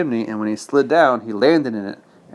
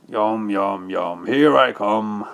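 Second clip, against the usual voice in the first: laughing wolf; 3 semitones lower, slower than usual. Acted. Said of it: "laughed the wolf".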